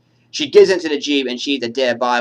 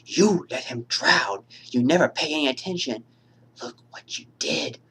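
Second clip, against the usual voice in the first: grieving accusation; 4 dB quieter than usual. Acted. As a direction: drag it out, hushed and bitter. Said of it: "from Miss Voorhees when she says".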